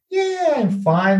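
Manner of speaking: mock unenthusiastic fine; grudging melody. Shown as a demonstration. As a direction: drag out "Yeah" with a reluctant, unconvinced melody. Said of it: "even in the tone of your voice and the melody".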